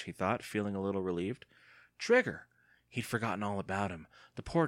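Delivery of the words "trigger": sudden alarm. A narrator's reading. Sudden remembering.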